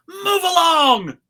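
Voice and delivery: high, loud dismissal; impatient order; cop's loud brush-off. Acted. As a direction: shout them along the street. Cop waves them on.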